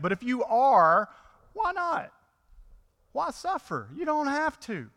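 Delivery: mocking tempter. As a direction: tease him to skip the suffering. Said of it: "Satan ... is trying to get Jesus".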